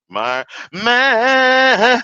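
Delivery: high, very drawn-out mock call of the name; mocking sing-song. Mocking the host to viewers, drawn-out teasing call.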